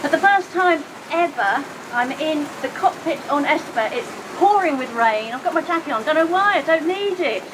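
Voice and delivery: ordinary delivery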